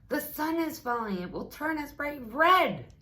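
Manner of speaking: high, loud alarmed chorus voice; frightened farm animals crying out together. A narrator's reading. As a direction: warn the donkey in a panic. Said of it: "they all said".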